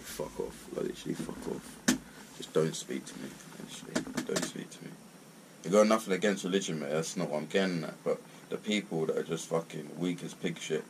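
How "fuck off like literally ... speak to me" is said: softer, breathy muttered dismissal; fed-up mutter. Real, not acted. Fed up with preachy people, muttered brush-off. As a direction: wave them off under your breath.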